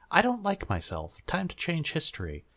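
high, lightly voiced mock Takuya; a hint of silly whiny teen. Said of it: "until Takuya decided".

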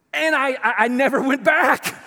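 high, loud exclamation; hammed-up cry of someone who bolted; retold outburst. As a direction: blurt it out at full voice. Retold woman fleeing Bible study.

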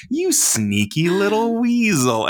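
high, slow teasing "sneaky little weasel"; teasing sing-song. Retold or imitated. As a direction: drawl it out like scolding a toddler playfully. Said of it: "So we tell Beckett".

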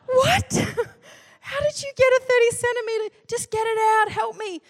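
high, incredulous, half-laughing; stunned disbelief. Retold or imitated. Wife hears about the ruler, high stunned disbelief. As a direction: gasp out in disbelief.

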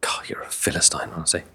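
whispered mock scorn. Real, not acted. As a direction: hiss it under your breath. Mock scolding aside.

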